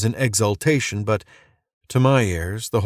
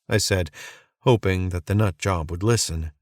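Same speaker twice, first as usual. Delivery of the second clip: plain reading voice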